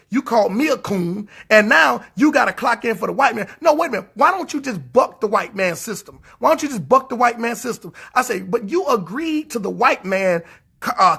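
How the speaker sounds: ordinary talk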